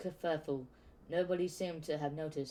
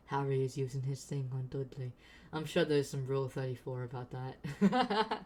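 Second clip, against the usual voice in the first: laughing aside. Real, not acted.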